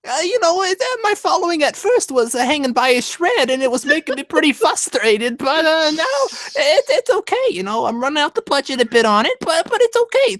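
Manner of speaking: high put-on voice of a friend; Andrew impression. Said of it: "Do I sound like Andrew?".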